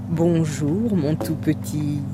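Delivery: low, sugary and coaxing wolf; the wolf greets the lamb in a low honeyed voice. Acted. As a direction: sweet-talk the lamb like a hungry wolf. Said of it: "d'une voix mielleuse".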